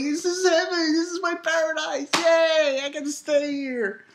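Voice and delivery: high, giddy voice; imitating a blissed-out character; mock overjoyed Cone. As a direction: gush over paradise. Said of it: "'Cause he's like".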